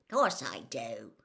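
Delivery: low grunting reply; low gruff Hagrid grunt. Acted. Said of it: "Hagrid grunted".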